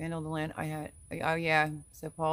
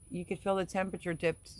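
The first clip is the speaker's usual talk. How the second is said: ordinary talk, nothing marked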